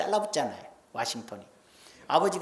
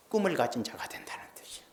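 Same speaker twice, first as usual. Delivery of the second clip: whisper; 3 semitones lower, 6 dB quieter, faster than usual. Acted. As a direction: lean in and breathe the words. Sermon point dropped to whisper.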